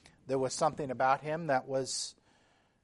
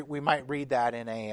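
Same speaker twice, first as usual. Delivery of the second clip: ordinary talk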